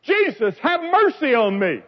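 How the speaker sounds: high-pitched cry for mercy; imitated yell. Preacher voices a blind beggar yelling for Jesus, high imitated cry. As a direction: call out pleadingly, drawing on the high notes. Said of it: "he yelled at the top of his lungs".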